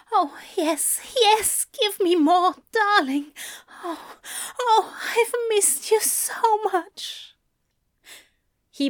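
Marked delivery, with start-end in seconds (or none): 0.1-7.3 high, breathy pleading voice; passionate pleading. A narrator's reading. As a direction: beg with rising passion. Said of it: "Aziraphale cries out".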